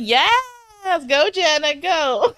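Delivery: high, drawn-out cheer; hammed-up rooting from the sidelines; mock cheering. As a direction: egg her on like a delighted onlooker. Acting out older women cheering.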